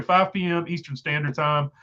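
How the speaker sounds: ordinary delivery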